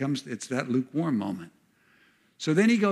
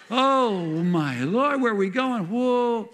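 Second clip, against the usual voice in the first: giddy amazement; 7 semitones higher, slower than usual. Acted. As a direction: reel like a happy drunk. Apostles drunk on the Spirit.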